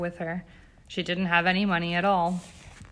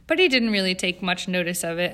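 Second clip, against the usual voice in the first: usual speaking voice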